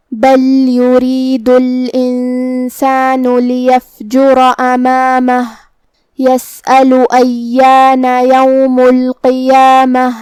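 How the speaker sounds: slow, chanted tajweed recitation; Quran recitation. Acted. Teacher reciting verses for the students. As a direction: recite the verses slowly and melodically.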